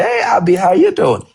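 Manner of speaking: relaxed excited greeting. Shown as a demonstration. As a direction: greet her like an old friend, full of energy. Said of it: "you're supposed to be".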